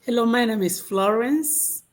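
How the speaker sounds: normal talking voice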